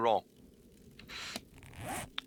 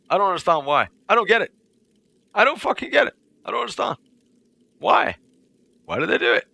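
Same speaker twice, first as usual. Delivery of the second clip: exasperated rising rant; 10 semitones higher, 4 dB louder than usual. Real, not acted. Venting to viewers, baffled and exasperated. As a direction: voice climbing higher in disbelief. Ranting, confused about women.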